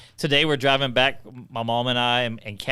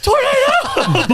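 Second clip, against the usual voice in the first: very high, slow, loud shriek with laughter; laughing mock scream; 8 dB louder, much slower than usual. [name] yelling in the truck during the storm, laughing shriek. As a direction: shriek it like a panicked passenger.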